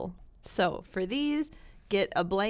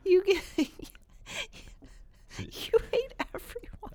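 laughing tease; 10 semitones higher than usual. Real, not acted. Teasing co-host, breaking up.

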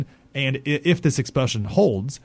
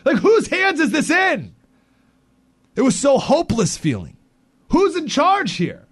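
much higher, slow, incredulous plea; exasperated; 13 semitones higher, slower than usual. Real, not acted. Host venting at a faceless bureaucracy, slow and incredulous. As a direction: throw up your hands in disbelief.

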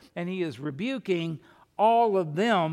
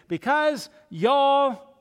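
slow, drawn-out Southern "y'all", louder and higher; 7 semitones higher, 7 dB louder, much slower than usual. Shown as a demonstration. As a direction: stretch "y'all" like a Texan preacher. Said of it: "we might say it like this".

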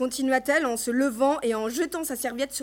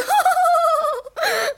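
high, loud mock laugh held on one pitch; hateful little laugh; 6 dB louder than usual. A narrator's reading. Mother scoffing at guests who ask after her daughter, hateful laugh. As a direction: laugh falsely, high and mean. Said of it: "avec son petit rire détestable".

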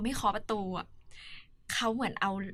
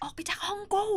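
mild mock yell; 9 semitones higher than usual. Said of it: "แบบตะโกนไล่".

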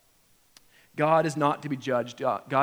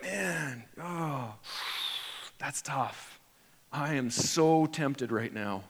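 mock tempted; much slower than usual. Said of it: "are you like".

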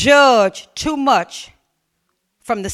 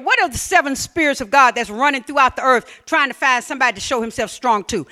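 normal talking voice

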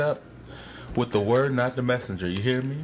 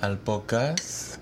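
put-on playful Spanish-accented greeting; 2 semitones lower, 5 dB quieter than usual. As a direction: welcome announced in a Spanish accent. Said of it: "throwing that damn accent on it".

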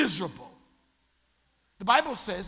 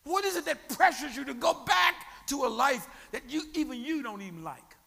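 loud, higher, driving sermon questions; full-force preaching; 5 semitones higher, 7 dB louder than usual. Acted. Pastor presses the congregation, loud rising preaching. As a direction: shout the challenge at the congregation.

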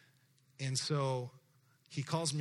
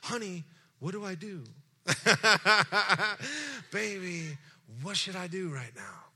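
lightly voiced pleading, a bit higher; pleading husband; 7 semitones higher, 6 dB louder than usual. Husband asking wife what to do, lightly pleading. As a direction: ask the wife for help, half laughing. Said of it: "And I say to my wife".

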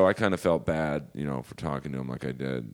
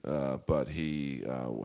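ordinary talk, nothing marked

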